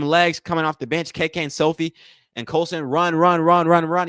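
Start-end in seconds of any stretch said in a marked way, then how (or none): 2.8-4.1 loud, urgent run run chant; excited cheering. Real, not acted. Streamer cheering on his team, shouting them down the court. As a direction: urge them on like a fan at courtside.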